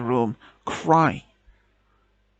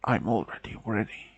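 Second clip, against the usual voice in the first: weak whisper; 4 semitones lower, 11 dB quieter than usual. A narrator's reading. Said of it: "he shook his head and said".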